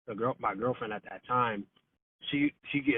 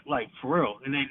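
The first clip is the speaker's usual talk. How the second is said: ordinary delivery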